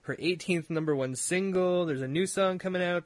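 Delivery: usual speaking voice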